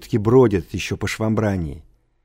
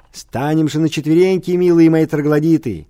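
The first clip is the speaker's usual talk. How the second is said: loud, high rallying call to the class; exuberant, raised-voice mock appeal; merrymaking; 5 semitones higher, 5 dB louder than usual. A narrator's reading. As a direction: playfully urge the crowd on. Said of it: "веселился Эмэ".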